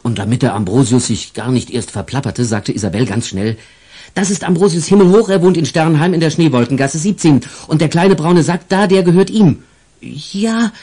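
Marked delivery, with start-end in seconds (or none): 4.2-9.6 put-on high, fast little girl rattling it off; quick girl voice. Isabel quickly speaks for the angel to the police, high girl voice. 10.0-10.7 put-on high hesitant child voice; stammering boy. The angel boy stammers to the police, high and hesitant. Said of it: "stotterte Ambrosius".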